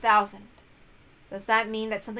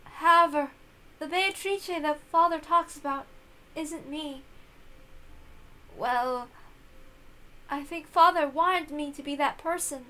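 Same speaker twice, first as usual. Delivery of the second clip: put-on higher, softening girl's voice fading to sad; sad young girl; 7 semitones higher than usual. Young Beato confides in her servant, higher and sadly fading.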